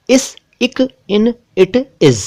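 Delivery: इस, इक, इन, इट, इज each spoken apart with a short i; crisp short words, pausing between them; word drill. Shown as a demonstration. Teacher reading short-i words to viewers. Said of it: "तो".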